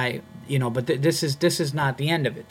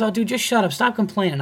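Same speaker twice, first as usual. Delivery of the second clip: retold exasperated scolding, pitched up; irritated scolding; 7 semitones higher than usual. Club employee telling the comedian off, irritated scolding. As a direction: mimic a guy fed up with whining.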